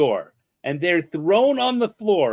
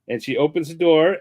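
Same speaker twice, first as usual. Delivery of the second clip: usual speaking voice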